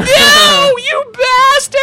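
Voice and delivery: very high, drawn-out comic shriek; anguished scream. Retold or imitated. Storyteller on the floor hearing Brandon, mock anguished scream. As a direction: howl it in hammed-up agony. Acted outburst at friend's betrayal.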